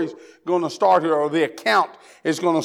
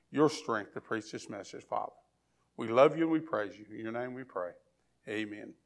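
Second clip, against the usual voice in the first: soft, low-voiced earnest prayer; 8 semitones lower, 10 dB quieter than usual. Real, not acted. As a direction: pray quietly and humbly to God. Opening prayer before the sermon.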